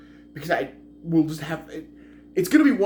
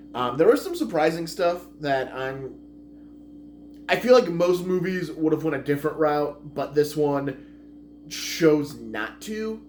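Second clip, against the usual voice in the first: ordinary talk, nothing marked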